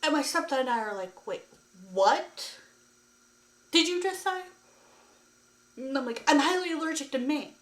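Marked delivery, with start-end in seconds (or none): 1.2-4.4 rising, high, louder disbelief; incredulous. Retold or imitated. Her stepdad and her reacting together. 6.3-7.5 high, louder and quick insistence; exasperated protest. Retold or imitated. Protesting to the dentist.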